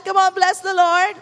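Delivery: rally the room to praise; rousing call. Real, not acted. Preacher calling congregation to bless the Lord, rousing.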